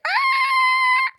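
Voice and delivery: high piercing squeal; imitated brake squeal. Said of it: "like that".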